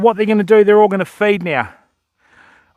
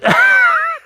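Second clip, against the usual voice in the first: high giggle. Real, not acted. After voicing the duck.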